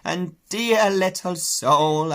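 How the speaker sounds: a fond ewe, drawn out; cooing sheep. Acted. Sheep fussing over Babe.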